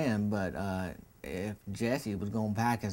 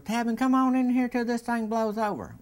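put-on much higher and louder, an old woman calling out; calling old lady; 13 semitones higher, 6 dB louder than usual. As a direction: call him inside in a high, loud old lady voice. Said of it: "she goes".

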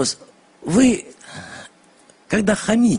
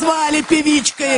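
high, raised indignant voice; shouting match; 10 semitones higher than usual. Real, not acted. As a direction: cry out in outrage. Heated TV debate quarrel.

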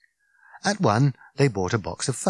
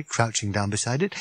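usual speaking voice

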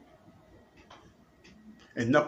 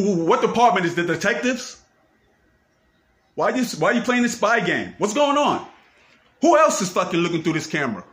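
loud, higher, furious accusations; angry yelling; 4 semitones higher, 4 dB louder than usual. Real, not acted. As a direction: bellow the accusations into the camera. Accuses police of spying, yelling.

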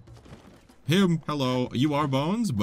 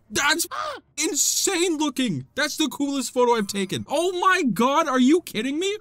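high, thrilled exclamation; amazed excitement; 12 semitones higher than usual. Real, not acted. Player thrilled with the photo, high amazed outburst. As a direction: marvel at it, pitch way up.

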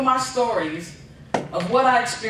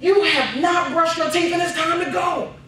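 mom calling out; 8 semitones higher than usual. Retold or imitated. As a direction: call the kid in from the next room. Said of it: "calls my brother".

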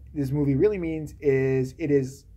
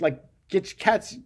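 ordinary delivery

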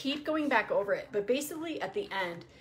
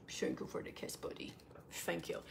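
hushed, half-whispered thanks; soft pet talk; 13 dB quieter than usual. Real, not acted. Thanking her dog for a kiss, hushed pet talk. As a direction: murmur it tenderly, close to the dog.

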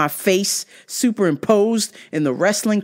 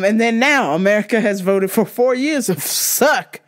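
usual speaking voice

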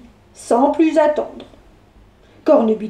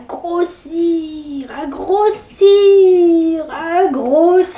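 very slow, stretched words; drawn out slowly; 2 semitones higher, much slower than usual. A narrator's reading. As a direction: stretch it as it grows. Narrator stretches growing.

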